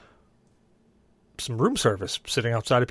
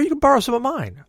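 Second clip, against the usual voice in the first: high brother voice; 12 semitones higher, 5 dB louder than usual. Retold or imitated. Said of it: "He's like".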